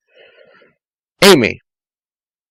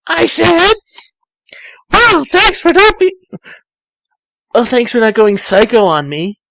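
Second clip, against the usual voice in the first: put-on very high, loud girly voice; high Amy; 10 semitones higher, 3 dB louder than usual. Amy reassuring Tails, very high girly voice. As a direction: chirp it as Amy. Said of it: "It's not so bad being a girl".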